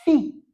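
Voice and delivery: fi said high and loud; teacher repeating fi clearly; contrastive fi. Shown as a demonstration. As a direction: punch fi to show the vowel. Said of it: "C'est pourquoi j'ai dit".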